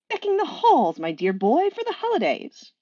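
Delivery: high, delighted singsong angel; cheerful fussy angel; Aziraphale's voice. Acted. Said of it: "I will do voices".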